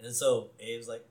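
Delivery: ordinary talk, nothing marked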